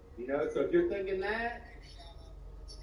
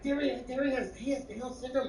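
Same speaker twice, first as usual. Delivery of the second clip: raised, lightly voiced explainer; neighbor explaining; 9 semitones higher than usual. Telling Derry's mom the truth, lightly voiced higher. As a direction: patiently spell it out, higher. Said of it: "He's like".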